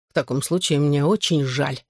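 usual speaking voice

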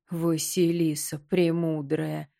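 slow creaky skull voice. Acted. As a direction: creak it out slowly. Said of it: "проскрипел он".

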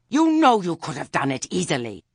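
high, loud, furious scream. A narrator's reading. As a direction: scream the accusation at her. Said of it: "Lettice's scream echoed off the mountains".

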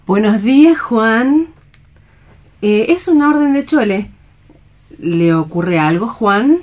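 put-on gentle young woman, light and concerned; stage-play Alicia greeting a friend; nurse-like Alicia checking on Juan; Alicia, bright and caring.

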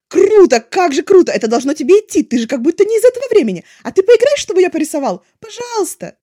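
very loud, high, overjoyed; gushing thanks. A narrator's reading. Wei Ying thanks Lanzhan, loud and overjoyed. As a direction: burst with delight at the compliment.

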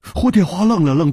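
ordinary delivery

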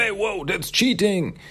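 hammed-up objection, voice shooting up; mock indignant protest. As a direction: act out a crying-foul protest. Tusk protests at being kicked.

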